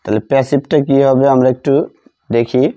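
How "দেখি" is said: ordinary talk, nothing marked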